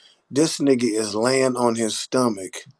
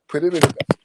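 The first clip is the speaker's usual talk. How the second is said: usual speaking voice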